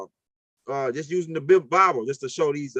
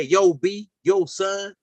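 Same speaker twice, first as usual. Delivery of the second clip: New York slang; slower than usual. Retold or imitated. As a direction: talk like a guy from New York. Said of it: "they got a different dialect in New York".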